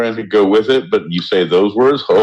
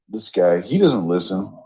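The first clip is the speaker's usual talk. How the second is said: ordinary delivery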